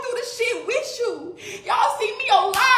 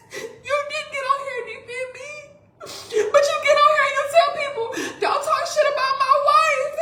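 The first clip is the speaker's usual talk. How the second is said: high, fast, tearful accusation; sobbing out the blame at him; crying; 6 semitones higher than usual. Real, not acted.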